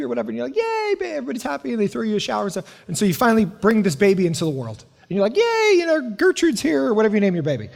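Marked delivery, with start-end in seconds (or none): 0.6-1.2 high, delighted little cheer; excited cheer. Retold or imitated. Couple celebrating the pregnancy. 5.4-6.8 high, beaming announcement; excited cheer. Retold or imitated. Parents welcoming the new baby.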